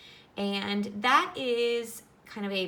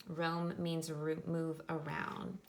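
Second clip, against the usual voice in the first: ordinary talk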